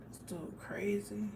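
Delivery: hushed unvoiced whisper. Uncle whispering to himself about his conspiracy-minded nephew. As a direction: whisper it like a secret aside.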